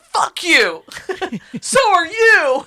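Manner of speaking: high, loud snapping back, with a laugh; hammed-up fury that cracks into laughter; mock angry yell. Heroine snaps back, laughing.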